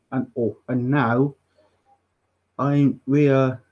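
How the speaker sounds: usual speaking voice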